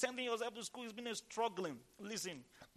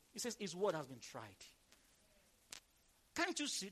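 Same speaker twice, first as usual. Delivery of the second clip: ordinary delivery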